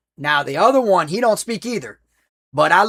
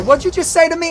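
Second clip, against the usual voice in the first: angry comeback; 10 semitones higher than usual. Retold or imitated. Teens angrily answer the drive-thru.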